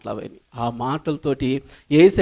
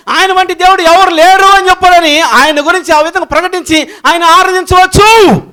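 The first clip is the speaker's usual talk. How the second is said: loud, high-pitched proclamation to a congregation; impassioned pulpit shout; full-force preaching; 12 semitones higher, 11 dB louder than usual. Acted. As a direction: shout each line like a rising chant of praise.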